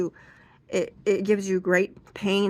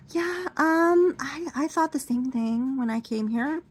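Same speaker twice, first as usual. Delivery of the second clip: high, chatty reassurance; lightly voiced beautician; 8 semitones higher than usual. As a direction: reassure the client chummily.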